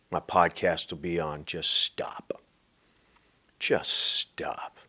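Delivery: slow, hushed whisper; soft, deliberate whispered plea; whispered punchline. Real, not acted. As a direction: drop to a whisper and draw out the words.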